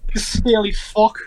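slow, put-on Scottish insult; Scottish accent. Shown as a demonstration. Player redoing his drunk character's insult on request, Scottish accent. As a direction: say it as a drunken Scot, drawn out slowly. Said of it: "say that in a Scottish accent".

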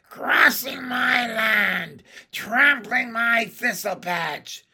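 high, loud, slow witch voice; drawn-out indignant screech; outraged hag. A narrator's reading. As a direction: rage at a trespasser as a crone.

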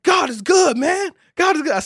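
high, loud shout of praise; a crazy praise shouted out. Retold or imitated. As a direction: shout God is good with joy. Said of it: "So I gave him a crazy praise".

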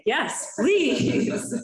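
comic over-keen yes; eager acceptance. Retold or imitated. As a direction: delightedly take up the offer, pitch leaping up. Acted eager reply to warning label.

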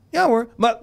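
half-laugh a nervous yes; accepting the tablet, nervous laughter; nervous agreeing. Retold or imitated. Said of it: "Kijk me".

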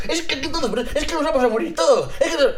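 put-on high, fast ranting old Spanish man; frustrated old Spaniard. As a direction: rant in a hammed-up Castilian accent. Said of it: "cuando es un español viejito que está como frustrado".

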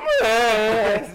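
loud high bleating goat noise. Retold or imitated. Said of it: "They go".

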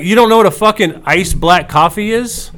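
can't believe what he's hearing, push it up; retold exasperated outburst at the drive-through speaker; exasperated disbelief. Ranting at barista about coffee.

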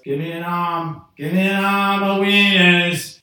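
loud call across the counter for wieners; ordering shout. Retold or imitated. Man shouting his wiener order at the diner counter, loudly. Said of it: "I'd order 'em by the arm's worth".

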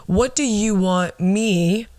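ordinary talk, nothing marked

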